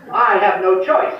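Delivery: loud, slow, drawn-out punchline; deadpan punchline. Retold or imitated. Telling the first lieutenant he has no choice, loud and slow. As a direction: land the joke slowly and loudly.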